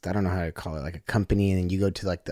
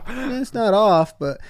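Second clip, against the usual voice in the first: laughing; 13 semitones higher, 6 dB louder, slower than usual. Real, not acted. Laughing while retelling.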